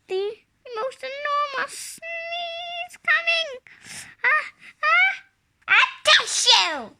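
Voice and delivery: hammed-up sneeze, rising to a loud blurt; sneeze building. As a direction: voice climbing high and loud toward a big sneeze.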